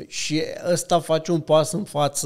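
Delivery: ordinary delivery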